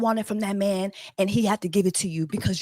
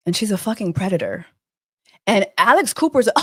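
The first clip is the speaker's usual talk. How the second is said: ordinary talk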